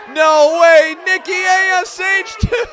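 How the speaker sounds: can't believe Nikki showed up, yell it; excited shout. Real, not acted. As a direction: fan shouting at the screen in disbelief. Reacting live to surprise entrance.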